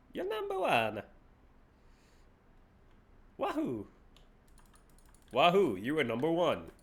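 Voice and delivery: lightly voiced Mario with a cheerful wahoo; half-hearted Mario cheer; Mario impression. Streamer doing Mario for the top supporter. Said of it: "a Mario that sounds like that".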